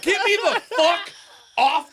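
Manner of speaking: high, loud yell breaking into laughter; loud high shout with laughs mixed in; laughing yell. Retold or imitated. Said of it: "as loud as you could possibly be".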